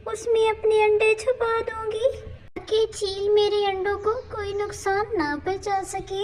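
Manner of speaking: anxious little bird talking to herself; worried mother bird. Acted. Bird realises kite is bad.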